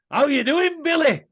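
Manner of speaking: put-on a fat kid eagerly calling to his hero. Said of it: "I would always do when Billy pulled up".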